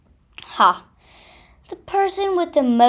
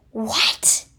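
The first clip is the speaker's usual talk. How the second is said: panicked. Acted. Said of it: "panicked Mister Rat".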